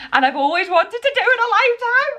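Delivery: high, laughing with disbelief; laughing, nearly in tears of joy; excited laughing. Real, not acted. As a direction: squeal it out in joy. Overwhelmed by opera tickets.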